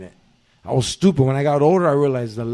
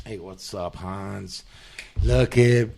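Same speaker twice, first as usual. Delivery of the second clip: mock Ellis; 8 semitones lower, 5 dB quieter, much slower than usual. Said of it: "You remember the movie Die Hard?".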